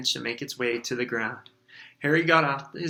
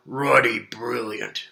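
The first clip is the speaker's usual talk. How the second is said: loud, slow booming giant; booming Hagrid; 5 dB louder, slower than usual. A narrator's reading. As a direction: boom it over the crowd. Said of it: "boomed Hagrid".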